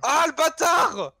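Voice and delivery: slow, high drawn-out cry of outrage; angry yell. Real, not acted. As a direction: drag out the curse in outrage. Caught by the killer.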